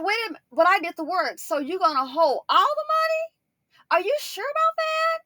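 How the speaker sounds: high-pitched, loud incredulous protest; hammed-up shocked questioning, voice way up high; acted indignant disbelief. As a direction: squawk in mock outrage at being cheated. Acts out outrage at withheld money.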